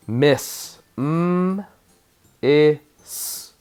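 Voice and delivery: "Miss" whole, then "M, I, S" high and separated; sounded out. Shown as a demonstration. Teacher restarts the word for spellers, sound by sound. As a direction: split "Miss" into hummed m, short i, hissed s. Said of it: "Syllable break aparts".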